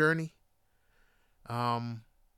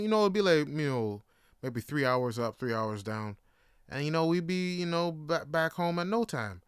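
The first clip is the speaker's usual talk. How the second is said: normal talking voice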